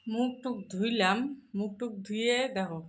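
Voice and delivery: soft, hoarse morning voice; real hoarse throat. Real, not acted. Morning after waking, showing her hoarse throat, soft and croaky. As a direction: talk through a real croaky throat, quietly. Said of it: "সকালে উঠে যদি এরকম করে গলা ধরে থাকে".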